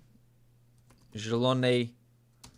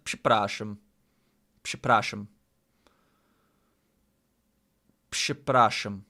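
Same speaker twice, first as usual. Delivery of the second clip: practised Polish accent, slightly lower pitch; 4 semitones lower than usual. Shown as a demonstration. Learner practising saying sorry to viewers, careful Polish accent. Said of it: "practice saying sorry in a Polish accent".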